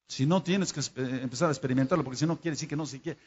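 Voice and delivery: ordinary talk, nothing marked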